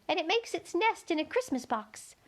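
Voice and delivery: high, thin little insect voice; a tiny gnat piping up to Alice. A narrator's reading. Gnat telling Alice where to look, high and thin.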